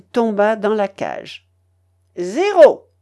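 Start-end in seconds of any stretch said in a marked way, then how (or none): 2.2-2.9 loud, high call of Zéro; croupier's cry. A narrator's reading. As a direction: call it out across the table. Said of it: "cria le croupier".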